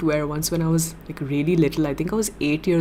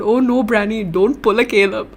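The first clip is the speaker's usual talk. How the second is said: loud mock-panicked plea; playful alarm; 4 semitones higher, 6 dB louder than usual. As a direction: plead loudly in playful alarm. Mock panic at viewer's comment.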